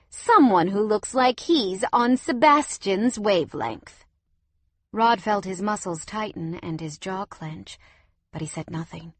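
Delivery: plain reading voice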